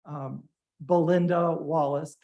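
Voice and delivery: usual speaking voice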